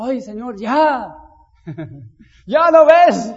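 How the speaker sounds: whiny plea rising to a loud, laughing, slow cry; impatient pleading. Retold or imitated. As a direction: beg God to hurry up, half laughing.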